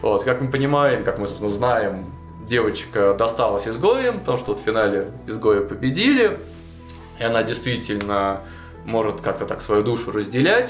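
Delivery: ordinary talk, nothing marked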